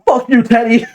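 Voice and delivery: high, loud mock producer-tag shout; hyped-up producer tag blasted at the start of a track. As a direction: yell it like a beat's signature drop. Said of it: "my tag is gonna be like".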